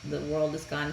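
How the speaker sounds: soft, downcast retold apology; mildly sorry, voice dropping away; apologetic. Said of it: "he is very apologetic".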